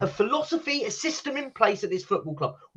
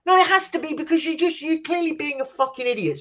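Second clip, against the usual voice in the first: exasperated yelling at the manager; angry outburst; 6 semitones higher than usual. Real, not acted. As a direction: let the fury spill out at the manager. Fan raging at manager.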